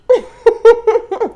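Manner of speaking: loud high burst of laughter. Real, not acted. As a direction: crack up.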